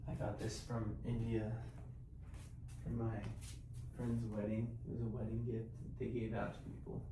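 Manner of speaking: hushed, soft-spoken morning talk; quiet. Real, not acted. I gotta be a little quiet 'cause Sean is sleeping.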